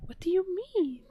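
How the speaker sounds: squeaky high cat-talk question. Real, not acted. Talking to the cat, higher.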